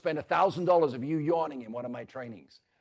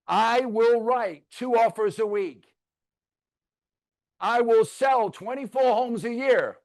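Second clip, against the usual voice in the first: slow, higher, louder vow for the room to repeat; mock wedding vows; 3 semitones higher, 5 dB louder, slower than usual. Shown as a demonstration. Pastor leading agents in mock vows, slow solemn recital. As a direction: lead the vows like a hammy pastor. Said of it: "You're gonna say".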